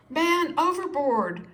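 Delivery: high, loud alarm cry; cried out. A narrator's reading. Sailors spotting George in the water, shouting out. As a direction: shout the alarm over the side. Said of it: "the sailors cried".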